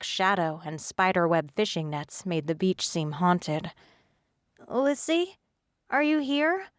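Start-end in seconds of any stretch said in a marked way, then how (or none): 4.7-6.7 high, hopeful call into the dark. Acted. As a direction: call out across the beach, higher. Calling for friend on beach.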